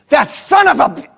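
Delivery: angry curse. Retold or imitated. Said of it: "He said".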